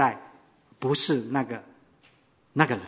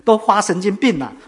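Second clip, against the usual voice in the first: louder and higher, rising in alarm; the gambler panics, pushing faster and louder; anxious outburst; 7 semitones higher, 9 dB louder than usual. Retold or imitated. Gambler frets at Bai Kongba, agitated and loud. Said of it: "就着急了".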